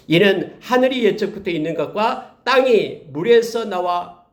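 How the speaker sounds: high, emphatic pulpit voice; impassioned sermon delivery, mostly high-pitched; forceful preaching. Real, not acted. Preaches scripture at full force.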